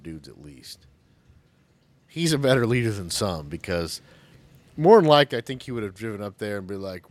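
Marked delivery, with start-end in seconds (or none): none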